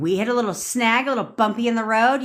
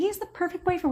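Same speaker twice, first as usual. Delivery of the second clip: higher, sugary mock girlfriend; mock sweet girlfriend; 11 semitones higher, 4 dB quieter, faster than usual. Mocking a smitten girlfriend's higher sugary brag.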